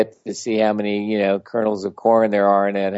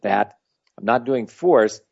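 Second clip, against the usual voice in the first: normal talking voice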